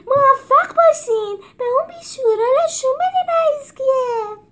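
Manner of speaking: tiny high squeaky mouse shouting goodbye; excited squeaky cheer. A narrator's reading. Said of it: "دست تکان داد و داد زد".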